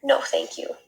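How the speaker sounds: breathy whispered sigh. Acted. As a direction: let it out on a tired sigh. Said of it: "sighed Camilla".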